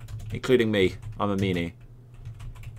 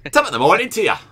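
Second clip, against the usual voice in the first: high, loud, hammed-up Irish greeting; attempted Irish accent; 6 semitones higher, 6 dB louder, faster than usual. Caster volunteering as the needed Irishman, mock Irish accent. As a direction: put on a stage-Irish accent, louder and higher. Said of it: "We need an Irishman as well".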